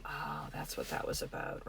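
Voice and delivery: soft whispered dawning understanding; whispered realisation. Retold or imitated. Parent at bedtime realising the child's upset, whispered. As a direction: breathe it out as it clicks. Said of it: "And you're like".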